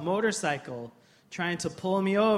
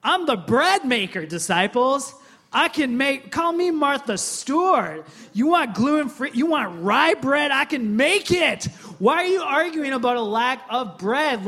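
higher, louder, exasperated Jesus pleading; like a teacher fed up with his class, raised voice; 5 semitones higher, 6 dB louder than usual. Retold or imitated. Preacher voicing Jesus scolding the disciples, exasperated and raised. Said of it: "And Jesus is like".

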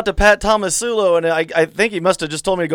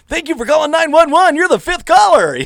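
loud, high, hyped DJ announcer; booming radio promo voice; 8 semitones higher, 5 dB louder than usual. Retold or imitated. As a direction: sell it like a morning show contest call. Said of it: "I said".

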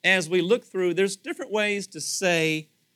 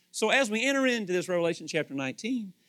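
ordinary delivery

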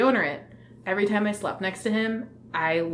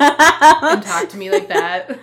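laughing it out loud; loud amused laugh; 8 semitones higher, 14 dB louder, much slower than usual. Real, not acted.

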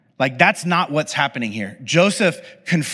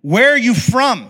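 higher, louder and slower, a demanding challenge; harsh demand; 8 semitones higher, 7 dB louder than usual. A narrator's reading. Joseph confronting his brothers, harsh demanding question. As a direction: bark the question at strangers. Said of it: "he spoke harshly to them".

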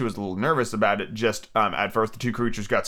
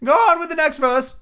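very high, loud turtle voice; Mock Turtle figure; 5 dB louder than usual. Mock Turtle urging Alice on, high character voice.

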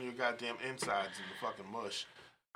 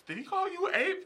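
incredulous; 4 dB louder than usual. Retold or imitated. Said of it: "And I went".

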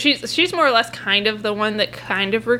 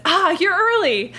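higher-pitched, loud exclamation in character; raised, high-pitched surprised greeting; 9 semitones higher than usual. Acted. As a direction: call it out brightly to cover a tense moment. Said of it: "loudly says".

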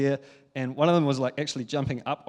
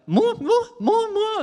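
put-on much higher, louder, wailing child; crying toddler; 5 dB louder, slower than usual. Toddler wailing for more video after it's turned off. She burst into tears.

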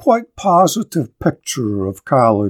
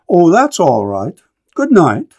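a lightly voiced boy's cheerful, higher goodbye; a quick, friendly farewell from a lad; young farm boy; 4 semitones higher, 3 dB louder than usual. A narrator's reading. Freshman cheerfully says good night to the lady, lightly voiced.

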